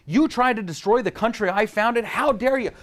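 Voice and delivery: high, loud outraged founder; stern George Washington. Retold or imitated. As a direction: scold like a furious founder.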